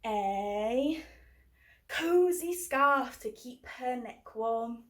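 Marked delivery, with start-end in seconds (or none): none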